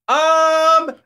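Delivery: very high, held um; hammed-up imitation of a calling um; drawn-out um. Houseguest's last call for the host, very high long um. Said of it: "the last".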